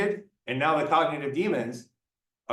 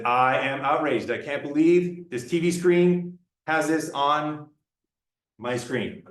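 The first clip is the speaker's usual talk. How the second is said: ordinary talk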